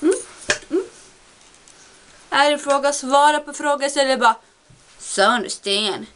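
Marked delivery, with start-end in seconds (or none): 5.0-6.1 drawn-out, slow mocking quote; hammed-up slow parody. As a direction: drag it out sarcastically. Mocking joke replies, slow.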